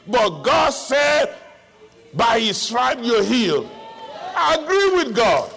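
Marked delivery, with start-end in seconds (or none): none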